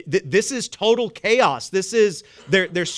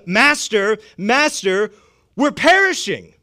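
higher, louder cry for help; panicked plea; 3 semitones higher, 6 dB louder, slower than usual. Acted. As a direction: call out urgently to wake the master. Disciples waking Jesus in panic.

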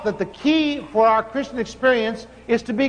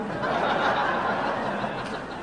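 soft laugh; 8 dB quieter than usual. Real, not acted. Laughs at own joke.

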